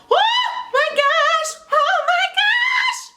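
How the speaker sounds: squealing very high, slow and drawn out; excited squeal. Real, not acted. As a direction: sing out the joy in a high squeal. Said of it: "I'm freaking out".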